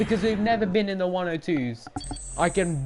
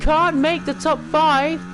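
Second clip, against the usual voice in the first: real thrilled commentary at the top of the voice; ecstatic; 9 semitones higher than usual. Real, not acted. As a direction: shout it like you just took pole. Takes pole, celebrates.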